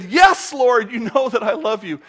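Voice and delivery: high, loud burst on "Yes"; acted eager insistence, starting high and loud; insistent answer. Acting out Peter's insistent answer.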